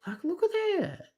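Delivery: excited squeal. Real, not acted. Gushing over the animal he is petting, excited squeal. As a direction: squeal at how cute it is.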